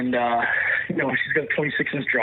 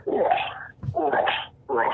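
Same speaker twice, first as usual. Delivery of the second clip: imitated moose grunts, spaced out; short animal grunts with pauses between; bull moose grunt; 9 semitones higher, slower than usual. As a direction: mimic an approaching bull's grunt. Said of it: "it's a grunt".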